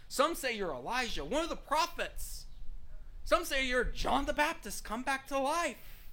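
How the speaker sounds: mock calling out. Disciples calling out lofty titles for Jesus, mock excited calls. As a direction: toss out lofty titles in swoops. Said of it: "disciples were shouting out all these lofty titles".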